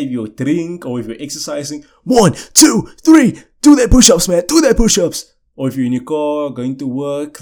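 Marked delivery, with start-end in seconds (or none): 2.0-5.3 loud, higher workout count; coach shout. Pretend. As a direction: bark the reps like a gym coach. Counting push-ups for listeners.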